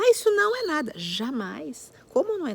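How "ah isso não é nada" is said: dismissive adult. Retold or imitated. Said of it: "não de desviar a atenção dela".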